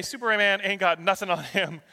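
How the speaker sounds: ordinary talk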